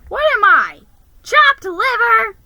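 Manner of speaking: high, loud offended yell; shrill hammed-up outrage; indignant shout. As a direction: bellow it, deeply insulted. Indignant outburst.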